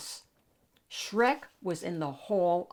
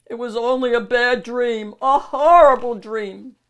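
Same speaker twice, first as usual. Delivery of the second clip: loud, rattled ogre voice, a little higher; Shrek waking up shaken; 5 semitones higher, 11 dB louder than usual. Acted. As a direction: blurt it out on waking from a nightmare.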